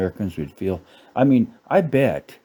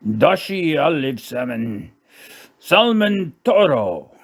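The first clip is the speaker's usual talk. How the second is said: mock Japanese accent; 5 semitones higher than usual. Comic reading the salmon menu, mock Japanese accent. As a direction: announce each dish in a booming fake Japanese accent.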